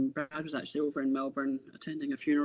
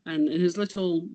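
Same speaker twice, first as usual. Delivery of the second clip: usual speaking voice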